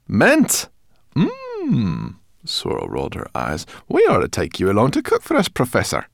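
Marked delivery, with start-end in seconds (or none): 0.1-2.2 high, savouring mmm; delighted brownie girl. Acted. 3.9-6.1 put-on higher, louder, fast cheeky girl; cheeky brownie girl.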